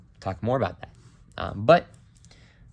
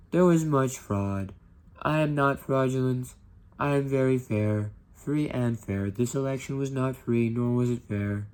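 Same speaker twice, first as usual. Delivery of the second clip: slow, loud, higher hammed-up insistence; mock Trump voice; 3 semitones higher, 4 dB louder, slower than usual. Teacher imitating Trump denying the election, hammed-up and loud. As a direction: play a blustering politician crying fraud.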